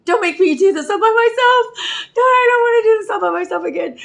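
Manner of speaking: high, loud, fast desperate plea; panicked pleading. Retold or imitated. Her own frightened thoughts, a high panicked plea. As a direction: beg like a scared child not to be left alone. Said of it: "my thoughts are like".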